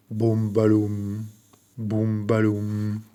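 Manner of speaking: thump out each syllable like a drum; storyteller booming the bear's footsteps. A narrator's reading. Bear's heavy drumming steps.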